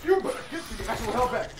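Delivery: loud, fast, high bravado; hyped trash talk. Real, not acted. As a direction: taunt them full of hype.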